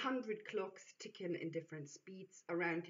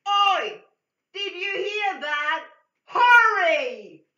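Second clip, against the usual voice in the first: much higher, much louder scream; 11 semitones higher, 19 dB louder, slower than usual. Acted. Distraction screams at the lady to hurry, screaming. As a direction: scream it right in her face. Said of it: "loudly screaming at the lady's face".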